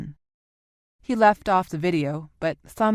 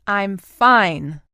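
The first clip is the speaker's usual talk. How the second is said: louder, insistent repeat of "I'm fine"; repeated firmly; 2 semitones higher, 8 dB louder than usual. A narrator's reading. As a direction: shut the topic down, pressing harder. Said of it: "she repeated".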